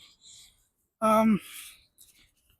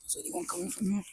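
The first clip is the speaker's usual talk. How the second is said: whispering; 11 dB quieter, faster than usual. Real, not acted. Passing people quietly.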